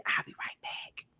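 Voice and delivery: secretive whisper. Retold or imitated. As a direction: slip away whispering to the friend. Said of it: "I say".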